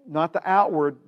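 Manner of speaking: usual speaking voice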